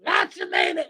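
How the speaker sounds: low, strained mock old lady; lady in wheelchair voice. Imitating the lady who yelled at her son. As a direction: croak it out like the frail lady at Subway. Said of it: "She's like".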